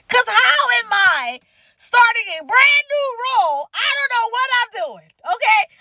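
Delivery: high-pitched, pleading overwhelmed rant; distressed venting. Retold or imitated. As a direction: pour out frustration, near tears. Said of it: "I'm like".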